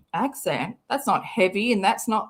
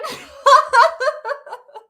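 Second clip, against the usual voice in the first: loud, high peals of laughter; real laughing fit; 8 dB louder than usual. Real, not acted. Reader unable to go on, loud high laughter.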